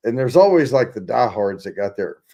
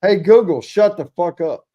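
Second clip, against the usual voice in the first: louder, higher sudden telling-off; snapping at phone; 6 semitones higher, 6 dB louder than usual. Real, not acted. Host cutting off a phone assistant that woke up, annoyed snap.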